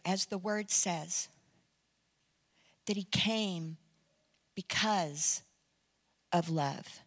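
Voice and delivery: low, very soft and slow; gently persuade, pausing between phrases; hushed, intimate plea fading away; tender preaching appeal. Retold or imitated. Soft closing appeal to the man.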